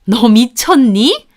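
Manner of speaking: loud, appalled scolding; shocked, accusing outburst; outraged mother-in-law. A narrator's reading. Shocked in-law sees money thrown. (기막혀 호통치는 시어머니)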